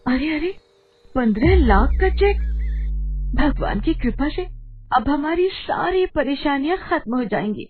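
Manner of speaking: high, excited exclaiming; amazed delight. Acted. Wife receiving a huge cheque from her husband, amazed delight.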